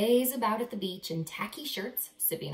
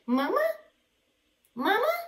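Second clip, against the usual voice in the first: high, loud little gosling calls; baby goslings; 13 semitones higher, 5 dB louder, faster than usual. Acted. Goslings calling Bruce their mother, high and eager. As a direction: call out like a lost baby bird.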